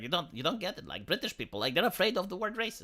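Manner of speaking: soft patronizing imitation of Sargon; mocking friend. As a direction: mimic him dismissively under your breath.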